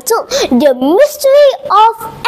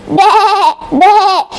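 wavering imitated baas; sheep bleat; 3 semitones higher, 3 dB louder than usual. Sheep with his voice back, bleating. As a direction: bleat twice with a wobble. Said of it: "bleated Sam the sheep".

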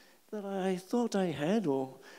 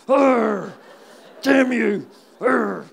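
loud, raised cursing growl; mock frustrated growl; 5 semitones higher, 9 dB louder, much slower than usual. Man curses the cop for being nice, mock growl.

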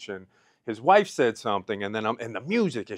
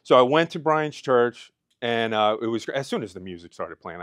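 ordinary talk

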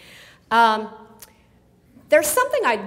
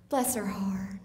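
catty bless her heart; 2 semitones lower, 8 dB quieter, slower than usual. Shown as a demonstration. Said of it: "the other one is".

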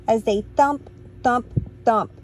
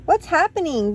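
blaring greeting; 6 semitones higher, faster than usual. Acted. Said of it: "blared Tacky".

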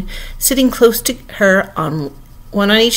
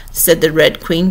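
ordinary delivery

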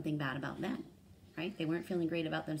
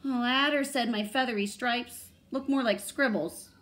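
high, loud storybook moan; mild theatrical moan, pitched up; moaning owl; 7 semitones higher, 8 dB louder than usual. A narrator's reading. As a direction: complain as a wounded owl, lightly acted. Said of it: "Owl moaned".

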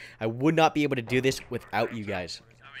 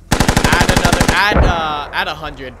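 shouting; 4 semitones higher, 10 dB louder, slower than usual. Real, not acted. Calling corrections to mortar team.

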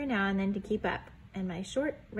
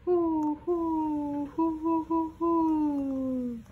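higher hooting owl call; 7 semitones higher, 3 dB louder, much slower than usual. Acted. Pa answering the owl as if chatting, hooting. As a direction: hoot back like a friendly owl. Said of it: "Then he called back".

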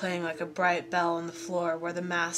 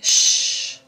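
long, loud shh; sharp hissing hush for silence; hissed shush; 12 dB louder than usual. A narrator's reading. Mounshroud silences the boys, hissing shush. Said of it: "hissed Mounshroud".

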